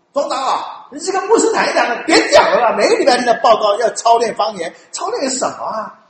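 loud, high, laughing protest; fed up members pleading with the elder; exasperated complaint. Retold or imitated. Congregation members begging the elder to stop, exasperated and loud.

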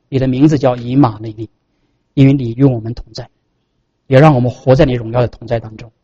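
low, measured closing prayer; leading prayer. Real, not acted.

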